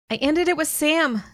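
I ended it with Sam, called out high; calling out. A narrator's reading. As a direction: call it after someone walking away. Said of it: "I called".

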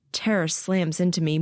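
plain reading voice

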